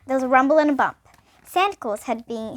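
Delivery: usual speaking voice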